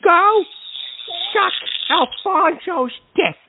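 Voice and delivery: spaced-out, emphatic words; slow, deliberate retort. Real, not acted. Host answering a mocking caller, slow deliberate retort. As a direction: spell out the insult word by word. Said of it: "Can't even say it straight".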